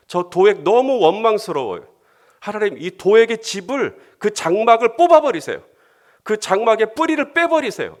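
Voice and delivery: angry pleading prayer. Pretend. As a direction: cry out to God to tear it up. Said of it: "다윗의 정직한, 어, 그런 기도죠". (분노하며 높고 크게 간구)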